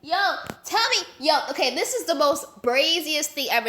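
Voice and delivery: ordinary talk, nothing marked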